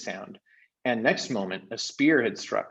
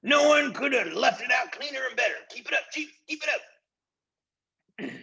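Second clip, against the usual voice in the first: high, odd flattering voice, last words dragged out; butter up the chief in a squeaky fawning voice; fawning chorus; 14 semitones higher, 5 dB louder than usual. A narrator's reading.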